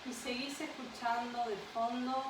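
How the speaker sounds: unhurried meditation voice with long pauses; guided meditation. Acted. Yoga guide leads a listener to an imagined beach, guided meditation. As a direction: guide them gently into rest, phrase by phrase.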